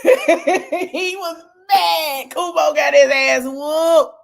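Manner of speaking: loud, high laughing gloat. Real, not acted. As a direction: crow over his defeat.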